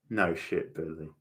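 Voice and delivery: normal talking voice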